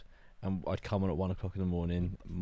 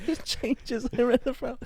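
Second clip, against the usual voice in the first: laughing mockery; 3 dB louder, slower than usual. Mocking his words, laughing.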